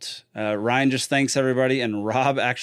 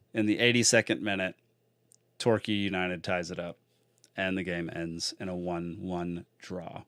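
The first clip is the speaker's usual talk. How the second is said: normal talking voice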